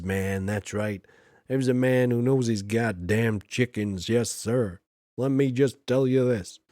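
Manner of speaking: put-on American accent. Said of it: "my American accent there".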